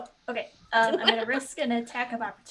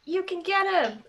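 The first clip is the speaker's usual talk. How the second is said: higher, louder in-character cheer; encouraging shout; 8 semitones higher, 7 dB louder than usual. Acted. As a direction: call out to rally an ally. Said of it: "I'm gonna be like".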